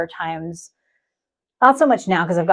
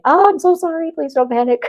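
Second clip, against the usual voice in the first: mock apologetic; 9 semitones higher, 3 dB louder than usual. Acted soothing message to staff.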